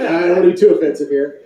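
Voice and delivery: ordinary talk, nothing marked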